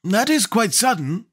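slow, high, unruffled character voice; calm Scarecrow. A narrator's reading. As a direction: take it calmly in a high put-on voice. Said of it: "said the Scarecrow calmly".

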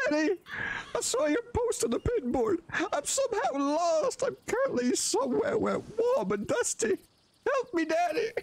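put-on high-pitched little boy pleading for his daddy; son's voice. Gamer voicing his son lost somewhere warm and dusty, high childlike plea. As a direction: squeak it out like a lost kid begging for help. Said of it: "I'll do it in his voice".

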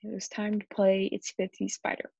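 usual speaking voice

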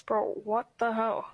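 ordinary delivery

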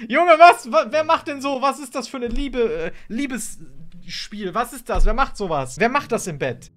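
high, loud baffled what; surprised disbelief. Real, not acted. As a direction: laugh out in disbelief. Shocked by odd animation. (ungläubig überrascht)

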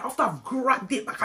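ordinary talk

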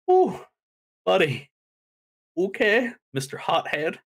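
high, teasing reaction to a threat; mock taken aback. Host reacts to Jay's threat.

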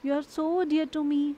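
You are so dear to me, said very slowly; slow clear example. Shown as a demonstration. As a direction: stretch the sentence out for learners. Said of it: "आप बोलते हो ना". (बहुत धीमे उदाहरण)

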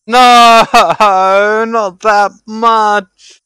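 high, loud, laughing no; laughing protest. Real, not acted. Streamer protesting to chat while laughing.

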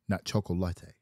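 "chocolate" said the Spanish way, choco-LA-te with a final "e"; "not chocolate" with the Spanish four-syllable ending; Spanish pronunciation contrasted. Shown as a demonstration. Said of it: "I know the way you say chocolate".

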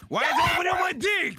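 shouting. Real, not acted. Shouted surprise mid-game.

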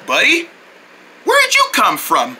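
usual speaking voice

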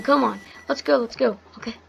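ordinary delivery

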